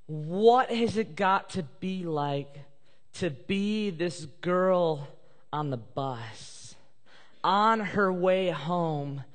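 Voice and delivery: drawn out, each phrase weighed; slow reflective preaching; slow and deliberate. Real, not acted. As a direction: linger on every word. Preacher slows to dwell.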